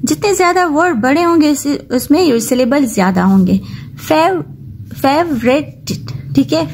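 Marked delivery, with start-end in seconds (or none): 4.1-5.8 favourite broken as "fev - ret", pitched up; syllable-by-syllable reading. Shown as a demonstration. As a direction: say favourite in separated parts. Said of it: "इसमें three syllable है".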